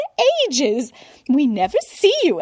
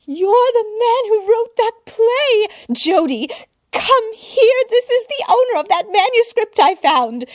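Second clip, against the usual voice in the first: much higher and louder, breathless gushing; an admirer who can't contain herself; gushing society woman; 13 semitones higher, 7 dB louder than usual. A narrator's reading. Society woman gushing at the butler, high and loud. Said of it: "the woman continued to gush".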